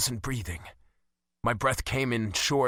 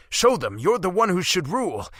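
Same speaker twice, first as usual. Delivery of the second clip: louder, pressing command; urgent insistence; 5 semitones higher, 5 dB louder than usual. A narrator's reading. Caspian pressing Sarissa to rule, loud and urgent. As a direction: push her to take the throne. Desperate urging, argument.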